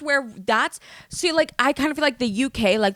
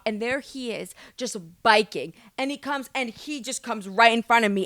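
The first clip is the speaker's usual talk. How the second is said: ordinary talk, nothing marked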